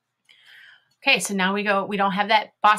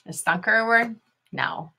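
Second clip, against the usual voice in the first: ordinary delivery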